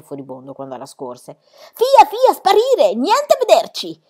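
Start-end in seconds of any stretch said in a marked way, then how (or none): 1.8-4.0 much higher, louder foreign shooing; furious director. A narrator's reading. Furious circus director shoos Pippi out of the ring. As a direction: chase the girl off in a rage. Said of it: "sibilò di nuovo fra i denti".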